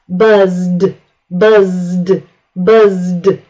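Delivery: "Buzzed" ending in a voiced D, one syllable; voiced final D. Shown as a demonstration. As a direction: say "Buzzed" with the buzz running into a D. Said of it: "add E D to the end".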